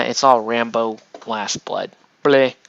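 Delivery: ordinary talk, nothing marked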